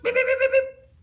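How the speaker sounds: loud, high blaring beeps; loud angry honks. Acted. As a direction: blast it like an angry truck horn. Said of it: "got mad and started honking back".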